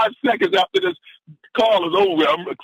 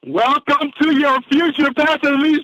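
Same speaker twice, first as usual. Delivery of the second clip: ordinary talk, nothing marked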